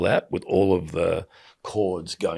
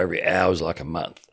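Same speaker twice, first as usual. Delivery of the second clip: usual speaking voice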